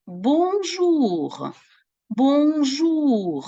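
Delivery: high, sing-song «бонжур»; teacher modelling a French greeting; model greeting. Shown as a demonstration. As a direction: greet them brightly with «бонжур». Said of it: "ми говоримо".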